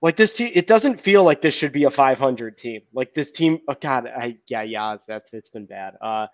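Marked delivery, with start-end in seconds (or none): none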